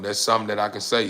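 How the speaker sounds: ordinary talk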